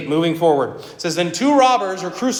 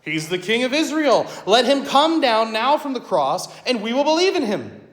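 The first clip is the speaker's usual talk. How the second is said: mocking priests; 6 semitones higher than usual. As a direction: taunt him, daring him to come down. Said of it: "they mocked him".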